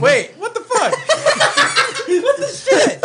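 laughing shock. Real, not acted. Reaction to first tequila sip.

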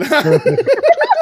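loud, high real laugh; high burst of laughter. Real, not acted. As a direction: break out laughing.

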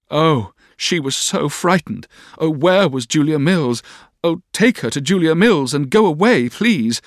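put-on high, fretful girlish outcry; frightened wailing. A narrator's reading. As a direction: cry out pleadingly, pitched high. Dora's frightened cries, reported.

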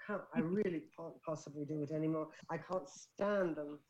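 put-on English accent. Woman performing for friends on request, English accent. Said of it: "Do that English accent again".

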